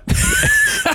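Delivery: sudden squealing laugh; high burst of laughter. Real, not acted. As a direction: shriek of laughter at yourself. Laughing at own remark.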